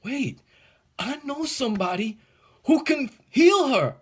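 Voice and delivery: a desperate dad who suddenly knows who can help; voiced father's rising hope; father's inner hope. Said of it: "what you said inside yourself that said".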